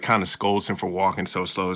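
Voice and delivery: ordinary talk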